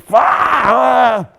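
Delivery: loud, high yell at a stubbed toe; cursing loudly after stubbing a toe; pained shout. Retold or imitated. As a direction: let the pain out with a full yell. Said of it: "Instead of being like".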